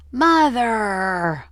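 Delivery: high, loud wailing call; drawn-out wail of Mother. Acted. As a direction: wail for her mother to save her. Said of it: "Phoebe wailed".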